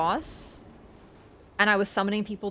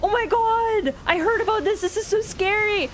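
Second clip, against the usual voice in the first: mock panic; 11 semitones higher than usual. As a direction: act out the terror in a squeaky voice. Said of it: "so scared".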